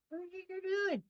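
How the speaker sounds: high-pitched mock scolding of a pet; squeaky pet voice. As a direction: tease the cat in a tiny squeaky voice. Repeats to the cat, much higher.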